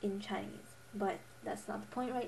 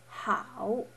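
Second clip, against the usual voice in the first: "how" dipping then rising; third tone. Shown as a demonstration. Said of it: "pronunciation tones".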